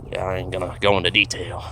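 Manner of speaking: ordinary delivery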